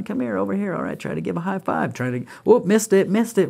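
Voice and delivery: bouncy mock game with a kid; playful game voice. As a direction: tease the little one into a game. Playing games with toddler.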